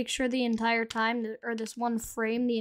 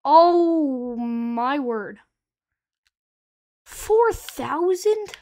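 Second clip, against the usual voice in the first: stunned disbelief; 8 dB louder, much slower than usual. Real, not acted. Shocked at huge damage number.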